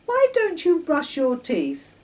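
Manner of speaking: put-on high, loud, nagging little-girl voice; persistent. A narrator's reading. Girl nagging Charlie to brush, insistent. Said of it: "Patricia continued persistently".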